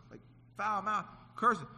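ordinary talk, nothing marked

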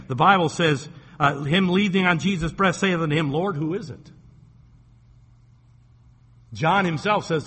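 loud, driving pulpit delivery; full-force preaching. Acted. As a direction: thunder the verses out to the congregation.

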